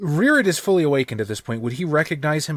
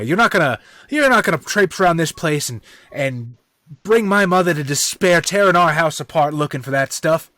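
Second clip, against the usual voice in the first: loud, raised indignant scolding; indignant protest; 4 semitones higher, 6 dB louder than usual. Retold or imitated. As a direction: rebuke the visitor, voice raised. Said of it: "Hutch would probably say something to the effect of".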